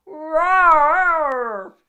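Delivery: huge lion roar; booming roar; loud roar. A narrator's reading. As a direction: let out a full lion's roar. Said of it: "he roared very loud".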